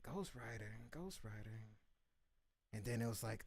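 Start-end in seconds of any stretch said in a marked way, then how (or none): 0.1-1.7 stunned murmur. Retold or imitated. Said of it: "And I was like".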